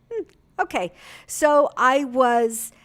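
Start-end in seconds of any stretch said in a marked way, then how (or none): 0.1-0.9 surprised okay. Retold or imitated. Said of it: "she goes".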